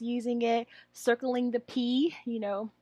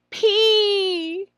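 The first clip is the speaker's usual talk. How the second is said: put-on high, loud child's answer; student answer; 9 semitones higher, 9 dB louder than usual. Shown as a demonstration. Trainer voices the student's reply, higher and louder. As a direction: sound out the letter like a pupil. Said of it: "let them say".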